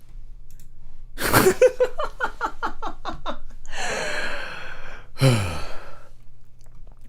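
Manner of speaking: put-on high airy girlish giggle; a breathy, high-pitched anime-girl laugh; girly giggle.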